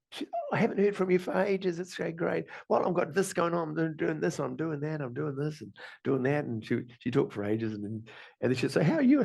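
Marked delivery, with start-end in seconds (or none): 0.3-6.4 higher, gushing chatty friend voice, rattling on; imitating the chatty friend. Retelling a friend's phone chatter, mock gushing. 8.7-9.2 much higher and louder mock cheery question; imitating the friend. Friend on the phone asking back, mock bright.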